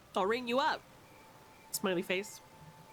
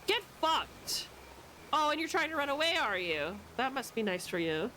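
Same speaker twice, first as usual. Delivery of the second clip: normal talking voice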